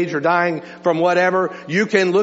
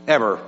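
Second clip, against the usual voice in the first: a lone "Ever." said lower with a firm fall; emphatic repeat; 6 semitones lower than usual. Shown as a demonstration. Pastor repeats ever to his church for emphasis. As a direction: set "Ever." down as a final full stop. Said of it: "For emphasis".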